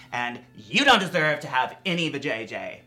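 shouting at viewers; loud. Real, not acted. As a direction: raise your voice and rant.

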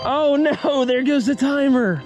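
usual speaking voice

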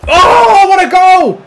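loud, thrilled shout at a goal; real joy at the screen; excited yell. Real, not acted. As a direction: roar it out in delight. Goal scored in the match.

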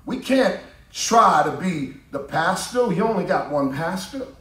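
ordinary delivery

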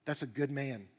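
admiring murmur. Retold or imitated. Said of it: "they go".

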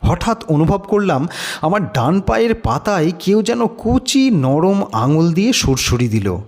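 ordinary talk

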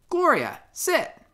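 high, crisp command to the dog; officer's high-pitched command. Acted. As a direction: show off the dog's obedience.

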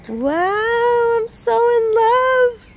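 high, loud, gushing wow; swooning, high and loud; thrilled. Real, not acted. Overjoyed at the finished paint.

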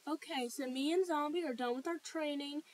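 usual speaking voice

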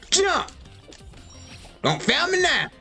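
usual speaking voice

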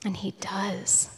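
breathy whisper, slow; whispered aside. Real, not acted. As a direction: drop to a grave whisper. Grim aside after the killing.

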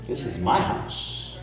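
low whispered threat; dad claiming his kitchen; low menacing whisper. Retold or imitated. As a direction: whisper it like a warning. Dad asserting.

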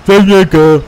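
loud call across the room; shouting. Acted. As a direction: yell for the technician. Calling for the technician.

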